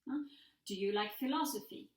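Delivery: ordinary talk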